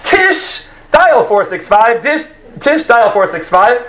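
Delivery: high-pitched agent paging a coworker; walkie-talkie page. Acted. Counter agent pages Tish on a walkie-talkie, high paging voice. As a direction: call out the name high, then give the extension. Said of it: "on a walkie-talkie. She goes".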